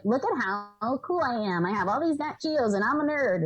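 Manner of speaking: hammed-up nerd. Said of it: "But I was just hamming it up a little bit".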